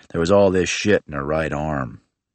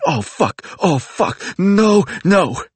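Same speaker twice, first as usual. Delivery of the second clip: climbing higher and louder into panic; panicked scream at the police car; 12 semitones higher, 3 dB louder, slower than usual. Retold or imitated. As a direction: scream in rising terror. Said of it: "Ryder started screaming".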